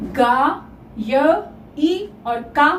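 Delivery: गा, य, ई, का spoken slowly and apart; slow teacher's breakdown with pauses between parts; syllable-by-syllable build. Shown as a demonstration. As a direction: sound out गायिका piece by piece.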